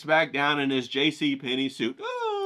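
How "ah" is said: squeaky high whimper; a tiny cowed squeak; meek dealer. Acted. Dealer shrinking back after the scolding, squeaky whimper.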